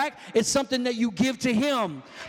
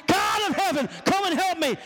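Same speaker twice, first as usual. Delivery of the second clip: high-pitched imitation of begging God; hammed-up prayer for help; mock plea; 6 semitones higher than usual. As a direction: cry out like a hypocrite praying for rescue. Mimicking a non-giver's plea to God.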